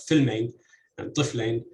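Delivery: "filmain" and "tiflain" stressed on "fil" and "tif"; front-stressed "filmain", "tiflain"; first-syllable stress. Shown as a demonstration. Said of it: "the initial syllable in these two words is, is the stressed".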